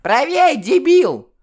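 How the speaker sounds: очень высоко и громко, по-детски грубо; рявкни как злобный ребёнок; детский окрик. Acted. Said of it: "раздался детский голос". (грубый детский крик)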